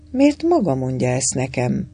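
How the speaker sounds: ordinary delivery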